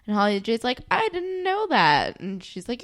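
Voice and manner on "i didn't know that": high, slow, loud mock innocence; imitated clueless excuse. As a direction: stretch out the high-pitched excuse. Voices Holly J's clueless excuse.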